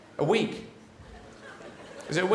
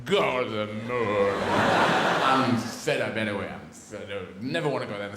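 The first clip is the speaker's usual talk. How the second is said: slow, drawn-out low groaning refusal; fed-up Russia; 6 semitones lower, slower than usual. Acted. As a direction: drag it out like a weary sulker, sinking lower. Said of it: "And then Russia went".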